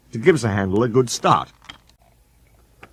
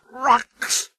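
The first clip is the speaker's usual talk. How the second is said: high scornful snort; indignant snort; 13 semitones higher than usual. A narrator's reading. Sir Handel snorting at quarry trucks. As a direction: spit it out in disgust. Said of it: "snorted Sir Handel".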